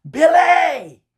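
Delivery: high, loud shouted name; mom's yell again. Retold or imitated. Mother yelling at her son again on the phone, loud shout. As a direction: bark the name like a fed-up mama. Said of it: "She yelled at me again".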